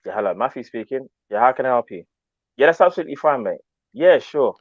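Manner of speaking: soft, polite call-centre voice; work phone voice. Shown as a demonstration. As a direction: tone down the slang, answer the phone politely. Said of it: "I'd be like".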